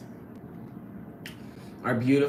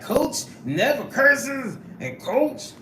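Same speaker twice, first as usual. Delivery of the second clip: teasing impression in a high, stretched-out voice; high mocking imitation, breaking into laughter; Supreme; 8 semitones higher, slower than usual. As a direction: mimic with drawn-out words, high and playful. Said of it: "Supreme be like".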